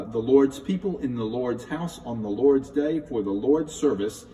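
slow, loud, measured declaiming of the saying; solemn recitation. Shown as a demonstration. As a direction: proclaim it slowly like a liturgical motto. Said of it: "I'll say it again".

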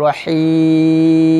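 রহিম with ই held very long; long drawn vowel. Shown as a demonstration. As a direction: stretch রহিম three alif. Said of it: "তখন বলবো". (তিন আলিফ টেনে রহিম)

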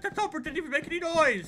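high, loud disbelieving cry; baffled. Retold or imitated. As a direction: relive the confusion loudly. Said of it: "I was like".

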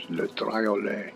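Le Triolet said slow in a hammed-up French accent; exaggerated French accent for a laugh; mock French accent. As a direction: camp French pronunciation, drawn out. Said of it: "As I speak in my outrageous, sexy French accent".

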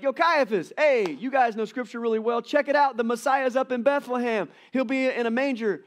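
high, loud, breezy street-style heads-up; casual angel. Pretend. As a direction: hype it up like a buddy spilling news. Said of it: "why didn't the angels be like".

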